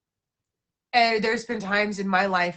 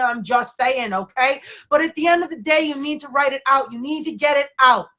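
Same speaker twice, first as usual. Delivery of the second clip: animated; 6 semitones higher, 6 dB louder than usual. Real, not acted. Passionate self-care advice.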